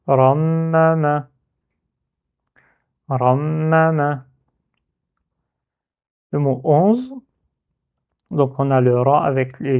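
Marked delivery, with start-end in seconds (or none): 0.1-4.2 "rammama" lu d'un seul tenant, lentement; lu d'un coup. Shown as a demonstration. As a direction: read "rammama" slowly in one go, holding the doubled m. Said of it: "on lit le mot d'un coup". (« rammama » lié d'un coup, m tenu)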